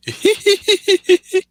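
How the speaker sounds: high, loud imitated chuckle of a cartoon doctor; Doctor Hubert laugh. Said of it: "I could do Doctor Hubert's voice".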